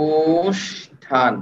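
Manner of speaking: অনুষ্ঠান stretched very slowly, each sound held; very slow drawn out. Shown as a demonstration. Teacher slowly sounding out অনুষ্ঠান for students. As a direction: draw the word out to hear every sound. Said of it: "নিজে নিজে try করেন".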